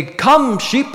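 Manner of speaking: high, loud herding call. A narrator's reading. Shepherd calling his flock out every morning, calling out.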